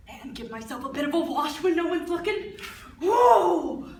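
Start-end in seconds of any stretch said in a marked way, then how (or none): 3.0-3.9 sudden loud gasp of shock, pitched up; cold shock. Acted. Woman splashing icy holy water on herself, shocked yelp.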